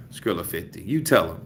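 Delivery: usual speaking voice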